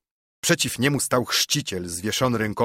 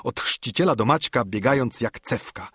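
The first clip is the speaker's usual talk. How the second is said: plain reading voice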